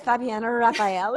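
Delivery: normal talking voice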